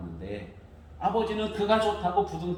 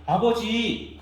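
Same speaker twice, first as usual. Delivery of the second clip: 아버지 raised and louder; respectful call; 7 semitones higher, 6 dB louder, much slower than usual. Retold or imitated. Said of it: "그것도 아니고".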